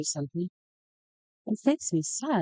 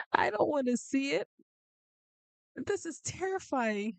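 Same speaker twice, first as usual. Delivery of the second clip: mortified; 8 semitones higher, 4 dB quieter than usual. Real, not acted. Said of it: "I'm mortified right now".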